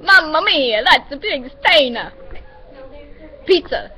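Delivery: loud, high, hammed-up Italian accent. Shown as a demonstration. Friend demonstrates an accent to Shell, exaggerated Italian accent. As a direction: ham up the Italian, loud and sing-song.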